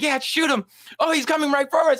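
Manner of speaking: put-on high excited kid voice; Cartman urging. Cartman urging Butters, high excited voice. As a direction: egg him on, high. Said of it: "He's like".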